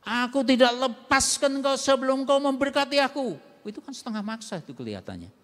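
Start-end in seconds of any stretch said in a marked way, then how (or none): 0.0-3.4 loud, insistent plea that won't let go; forceful demand. Acted. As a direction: Jacob gripping tight and demanding a blessing. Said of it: "kelihatannya memaksa loh Yakub itu".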